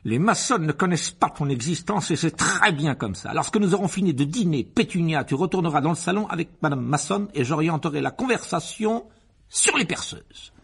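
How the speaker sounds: high, swelling, exultant uncle; pompous Uncle Vernon scheming. A narrator's reading. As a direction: build to a triumphant laugh. (oncle pompeux, de plus en plus exalté)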